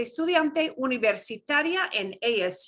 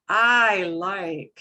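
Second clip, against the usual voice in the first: "I like" with the I punched louder; emphatic I like; 3 semitones lower, 6 dB louder than usual. Shown as a demonstration. Teacher demos emphasis to a student, punching "I". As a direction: lean hard on the "I". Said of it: "listen to the inflection of my voice".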